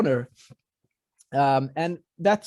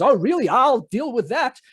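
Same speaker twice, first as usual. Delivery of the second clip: sarcastic guard; 7 semitones higher, 4 dB louder than usual. Acted. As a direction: sneer then promise to deal with it. Guard responds menacingly.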